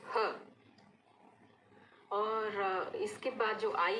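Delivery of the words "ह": ह as a breath of air; breathy ह with no vowel; phonics h sound. Shown as a demonstration. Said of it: "उसके बाद है". (हवा जैसा ह)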